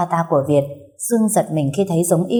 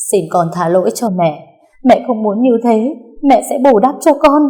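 rising, louder voice of a crying mother; tearful pleading; 5 semitones higher, 5 dB louder than usual. A narrator's reading. Mother begging her child's forgiveness, tearful plea. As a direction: beg desperately, building higher and louder. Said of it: "khóc lóc không ngừng".

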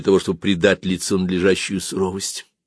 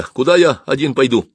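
raised, insistent question; stubborn; 6 semitones higher, 4 dB louder than usual. Acted. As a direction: press the point obstinately. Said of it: "упрямо повторил я". (упрямо)

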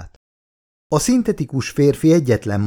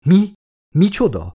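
higher-pitched disbelieving questions; startled alertness; 9 semitones higher, 4 dB louder than usual. A narrator's reading. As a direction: jolt awake at shocking news. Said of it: "élénkült fel a nő".

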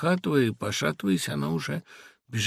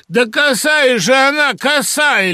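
high, loud, urgent babbling; insistent babble; 12 semitones higher, 11 dB louder, slower than usual. A narrator's reading. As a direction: insist loudly in a high old man's voice. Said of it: "лепетал мне в ухо Латкин". (лепет, громко и высоко)

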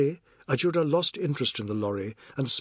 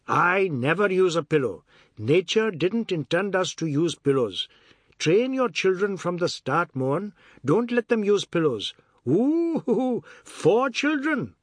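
lecturing, teasing Ajoda; 5 semitones higher, 4 dB louder than usual. Acted. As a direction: preach it, then hoot with mischief. Ajoda lectures, then hoots teasingly.

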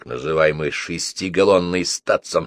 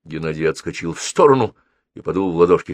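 usual speaking voice

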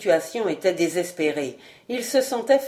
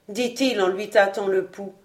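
ordinary delivery, nothing marked

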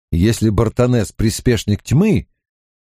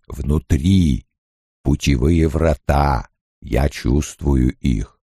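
low, rumbling gentle giant; deep Ogier voice; 8 semitones lower, slower than usual. A narrator's reading. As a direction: speak low and slow like a huge Ogier. Ogier Loyl voiced by narrator.

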